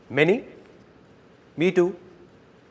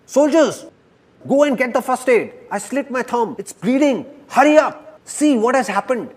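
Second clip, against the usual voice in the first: high, urgent acted cries; screaming in pain; 9 semitones higher, 3 dB louder than usual. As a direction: call out for help as a hurt king, pitched high. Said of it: "starts screaming".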